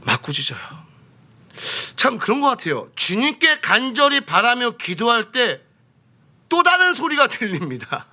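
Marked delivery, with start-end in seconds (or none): 2.0-8.1 high, emphatic pulpit delivery; forceful preaching. Real, not acted. Preacher warning those who pray earnestly, high and forceful. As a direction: drive the warning home.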